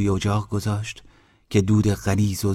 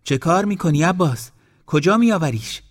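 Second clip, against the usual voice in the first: much higher, calling out across the wall; shouting in surprise; 9 semitones higher, 4 dB louder than usual. Acted. Aunt spotting Abbas with the dog, shouting higher. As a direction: shout it over the wall in alarm. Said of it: "داد زد".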